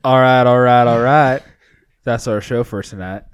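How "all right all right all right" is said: McConaughey catchphrase. Retold or imitated. Signature sign-off catchphrase, loud.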